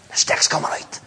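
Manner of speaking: menacing whisper of a mum; whispered threat. Retold or imitated. Mum warns the lying boys about the stick, in a whisper. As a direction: breathe the warning, voiceless.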